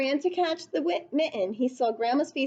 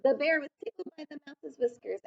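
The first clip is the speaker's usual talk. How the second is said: plain reading voice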